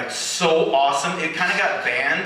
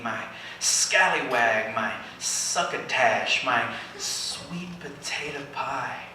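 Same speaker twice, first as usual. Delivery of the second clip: fading pleas; 6 semitones lower, 6 dB quieter, slower than usual. Acted. Fading pleading poem lines.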